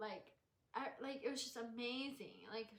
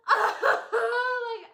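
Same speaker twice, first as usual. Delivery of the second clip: high, very loud tearful wail; mock bawling; 12 semitones higher, 19 dB louder than usual. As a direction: wail it out, imitating your own crying. Reenacts herself bawling after baptism.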